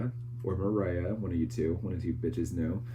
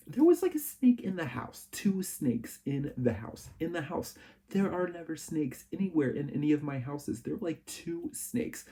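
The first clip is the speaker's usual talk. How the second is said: horrified; 8 semitones higher than usual. Real, not acted. Horror at snakes in house.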